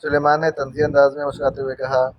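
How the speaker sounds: usual speaking voice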